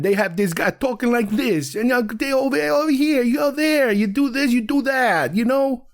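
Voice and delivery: high-pitched mock New York movie guy. Critic mocking how Hollywood movies make New Yorkers talk, exaggerated New York accent. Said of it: "stereotypical New York accents".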